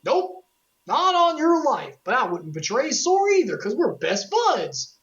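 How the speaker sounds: high, goofy sidekick voice, pitched well up; goofy cartoon sidekick. Goofy, loyal to Sora, refusing to betray him in a high goofy voice. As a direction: play the loyal dopey pal. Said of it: "And Dawn and Goofy are like".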